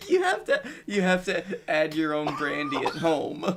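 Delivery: words through laughter; giggling through the joke; laughing. Real, not acted. Laughing while joking.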